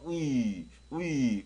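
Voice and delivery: 위 drawn out, starting from rounded lips; pulled W sound. Shown as a demonstration. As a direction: stretch 위 wide and slow. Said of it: "많이 벌려주고 뒤로 당겨줘야 돼요".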